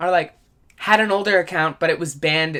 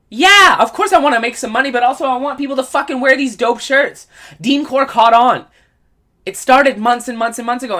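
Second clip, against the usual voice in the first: excited; 7 semitones higher, 9 dB louder than usual. Real, not acted. Hyping his merch with passion.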